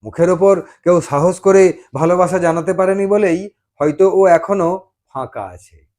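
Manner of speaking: usual speaking voice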